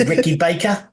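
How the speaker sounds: put-on high, loud New Zealand accent; a hint of a Kiwi voice. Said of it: "trying to do a Kiwi accent".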